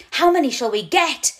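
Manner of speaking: loud, high eager shout. A narrator's reading. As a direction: call out over the wind. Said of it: "Mousetrap shouts".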